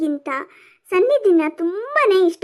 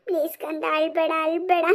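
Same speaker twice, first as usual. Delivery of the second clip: soft, hushed comforting; tender pleading; 3 semitones lower, 6 dB quieter than usual. Acted. Mother begs her daughter to stop crying, tenderly. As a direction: soothe the child, voice dropped low.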